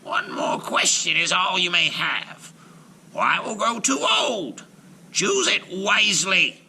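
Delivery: high whispered warning witch. A narrator's reading. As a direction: warn her like a hag. Baba Yaga voiced.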